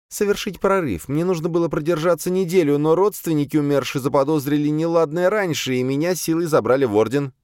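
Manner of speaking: high-pitched female character voice, matter-of-fact account; servant demoness recounting. A narrator's reading. Azalia begins her account to the exorcist, high servant voice. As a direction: raise the pitch well up for the demoness.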